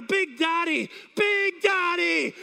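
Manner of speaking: high, stretched-out shout; imitated desperate call. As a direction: call out for your tag partner. Wrestler desperately calls his partner.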